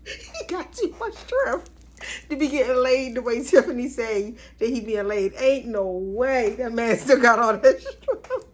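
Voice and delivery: high, cracking up through the words; can't stop laughing at him; laughing while talking. Real, not acted. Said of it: "I'm sorry".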